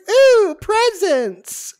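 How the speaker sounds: very high, loud, drawn-out squeal; mock delighted excitement. Teller whose eyes light up at presents, exaggerated slow squeal. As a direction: stretch it out in hammed-up childlike glee. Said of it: "her eyes light up like it's Christmas morning".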